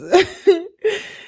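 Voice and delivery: high, loud burst of laughter; real laugh. Real, not acted. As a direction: burst out laughing mid-reading. Laughs before reading on.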